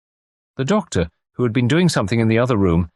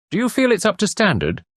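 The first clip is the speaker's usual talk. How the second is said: a mildly anxious, much higher query; 14 semitones higher than usual. A narrator's reading. Peter to Harriet about the baby, mildly anxious. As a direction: ask nervously if the baby passes muster. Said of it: "he inquired with some anxiety".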